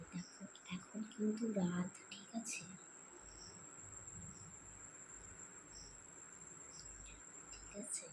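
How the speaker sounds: hushed whisper so no one wakes. Real, not acted. Sneaking at night so family won't wake, whispering. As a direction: breathe the words, everyone's asleep.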